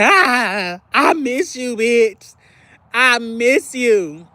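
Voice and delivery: playful ache of missing a friend; whiny longing. Retold or imitated. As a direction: stretch out the missing like a lonely plea. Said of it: "I'm like".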